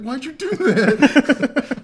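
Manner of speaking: high, loud, drawn-out mock wail; hammed-up hysterics through amusement; mock panicked wail. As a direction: imitate a panicked cry, slow and stretched. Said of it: "panicking, like".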